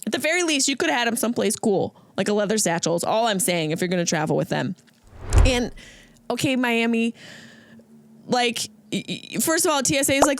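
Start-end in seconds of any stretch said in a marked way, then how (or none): none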